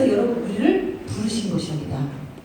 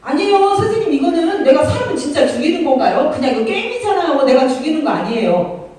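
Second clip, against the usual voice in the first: higher, louder mock student protest; 4 semitones higher, 5 dB louder than usual. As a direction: argue back like a kid defending a game. Acts a student protesting to teacher. (선생님께 억울한 듯 높고 크게 항변하는 학생 흉내)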